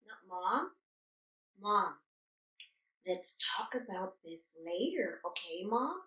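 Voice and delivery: soft, hushed reasoning with mom; retold strained patience. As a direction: keep your voice down, patiently putting mom off. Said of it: "I'm trying to be patient".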